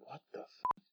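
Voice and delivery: high, hushed squeak of shock; mock disbelief. Reacting to the twist in the video, high hushed squeak of shock. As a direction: gasp it out stunned and tiny. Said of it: "And I'm like".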